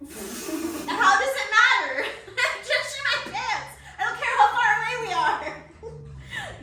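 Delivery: high, laughing exasperated rant, slowed; laughing mock outrage. Telling the boyfriend off through laughter. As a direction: retell the panic through laughter, slow and loud.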